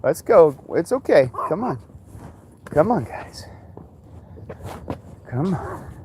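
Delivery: high, sing-song call to the dogs. Real, not acted. Dog owner calling dogs on walk, high coaxing call.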